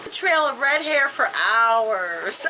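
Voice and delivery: ordinary delivery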